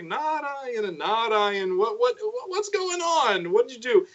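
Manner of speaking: rising high flustered questions; mock alarm. Narad finding the hiding gods, rising flustered alarm.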